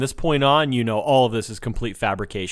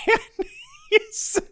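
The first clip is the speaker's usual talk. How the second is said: laughing. Real, not acted. Laughing while retelling scene.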